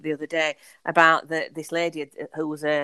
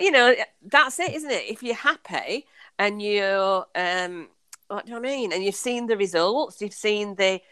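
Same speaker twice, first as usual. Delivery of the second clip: ordinary talk, nothing marked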